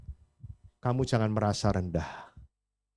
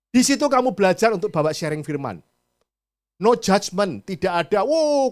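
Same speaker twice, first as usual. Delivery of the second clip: loud, urging call to the congregation; rally them from the pulpit; loud pastoral appeal; rousing preaching; 4 semitones higher, 9 dB louder than usual. Acted.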